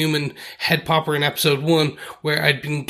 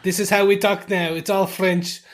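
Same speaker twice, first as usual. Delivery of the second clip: higher-pitched, hammed-up French accent; 5 semitones higher than usual. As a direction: lay on a silly French accent. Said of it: "I can go French on".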